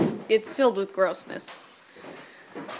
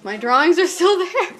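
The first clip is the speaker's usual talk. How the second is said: excited; 8 semitones higher, 10 dB louder than usual. Real, not acted. Finds his old drawings.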